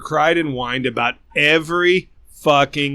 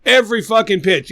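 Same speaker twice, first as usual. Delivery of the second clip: normal talking voice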